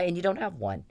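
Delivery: usual speaking voice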